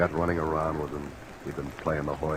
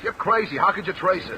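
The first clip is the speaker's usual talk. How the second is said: nervous outburst; 5 dB louder than usual. Acted. Suspect panics under questioning.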